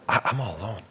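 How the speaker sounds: forlorn. Retold or imitated. Voicing isolated feeling.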